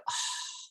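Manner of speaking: breathy, voiceless "ah"; awed gasp. Acted. Trainer acting the before-and-after reveal, awed gasp. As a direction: let out a hushed gasp of wonder. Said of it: "that is the ah moment".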